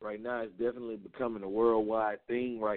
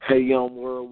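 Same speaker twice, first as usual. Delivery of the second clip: loud shout-out; 2 semitones higher, 10 dB louder, slower than usual. Real, not acted. Radio host greets listeners.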